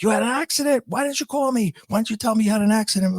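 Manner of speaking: high-pitched mock alarm, rushing towards the end; overreacting kid, imitated. Kid reacting to dad's car accident, imitated high-pitched alarm. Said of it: "Because you're reacting like this".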